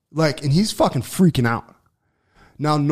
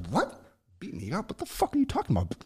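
incredulous; 6 semitones higher, 11 dB quieter, faster than usual. Retold or imitated. Stunned by a stranger's threat.

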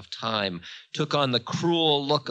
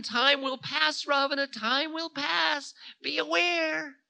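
very high, ominous wail of a voice; Time, prophesying doom; 3 dB louder than usual. A narrator's reading. Time telling Ravana he is doomed, ominous. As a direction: keep it pitched high, slowing to a final warning.

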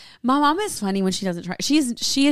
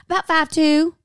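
higher, mock mom's sunny reply; 6 semitones higher, 4 dB louder than usual. Daughter imitating mom's answer to the valet. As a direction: pitch it up like mom.

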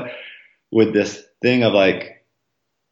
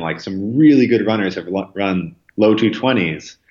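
ordinary talk, nothing marked

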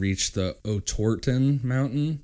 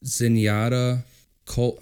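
normal talking voice

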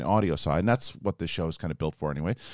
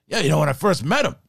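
Al Pacino impression; 6 semitones higher, 4 dB louder than usual. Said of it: "started watching Pacino".

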